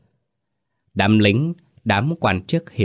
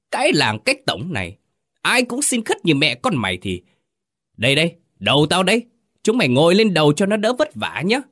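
shouting in rage; 8 semitones higher than usual. Acted. Village chief screaming at the indebted widow, furious. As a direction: bellow abuse at the cringing pair. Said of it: "Lão quát".

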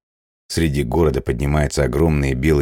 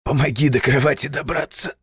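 strained plea; 11 semitones higher than usual. Acted. Weak plea after pain.

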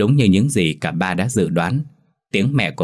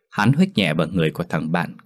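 plain reading voice

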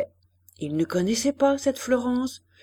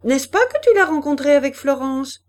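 brusque, louder question; abrupt appeal in a raised voice; 4 semitones higher, 6 dB louder than usual. A narrator's reading. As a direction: demand confirmation, pitched up. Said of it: "demanda brusquement madame de Berle en haussant la voix".

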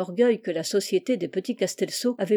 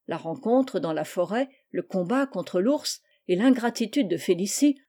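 ordinary delivery, nothing marked

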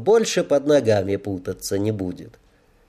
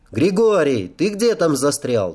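mild raised-voice call, pitched up; narrated shout, only moderately loud; 6 semitones higher, 3 dB louder than usual. A narrator's reading. Comrade hurries the straggler from behind sheds, mild call. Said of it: "донёсся крик из-за сараев".

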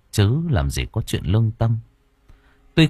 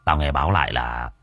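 put-on lower, fast, drunkenly slurred; slurred drunk voice; 6 semitones lower, 3 dB quieter, faster than usual. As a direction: slur it like a thug deep in his cups. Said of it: "giọng lè nhè".

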